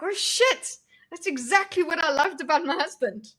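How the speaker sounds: high, surprised Oh shit; sudden realization. Retold or imitated. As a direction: relive the moment it clicked. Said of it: "I was like".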